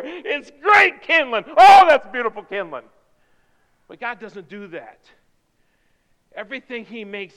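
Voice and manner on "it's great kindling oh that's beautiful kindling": loud, exaggerated delight; mock admiration. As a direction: gush over it at full volume. Acting out praise of scrap wood.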